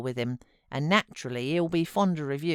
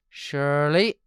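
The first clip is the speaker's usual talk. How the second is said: put-on old servant; 5 dB louder than usual. A narrator's reading. Joseph's voice.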